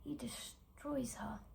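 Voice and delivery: slow, near-whispered dramatic line; hushed, haunted. Acted. Actress ending the tale of a ruined girl, hushed and haunted. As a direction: let it sink into a slow whisper.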